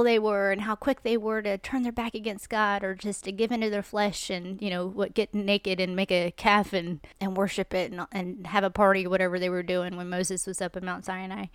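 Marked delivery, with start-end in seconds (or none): none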